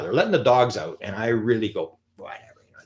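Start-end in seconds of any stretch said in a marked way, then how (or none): 2.1-2.8 dismissive. Retold or imitated. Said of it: "this is my impression now".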